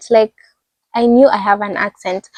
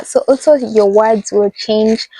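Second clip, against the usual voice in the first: normal talking voice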